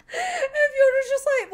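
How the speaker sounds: usual speaking voice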